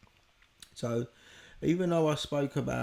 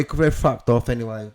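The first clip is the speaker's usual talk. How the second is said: normal talking voice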